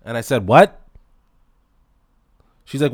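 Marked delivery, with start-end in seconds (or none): 0.5-0.8 sharp loud disbelieving what; shocked what. Retold or imitated. He hears the twenty dollar limit, loud shocked what. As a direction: blurt it out in disbelief.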